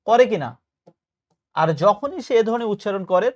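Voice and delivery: ordinary delivery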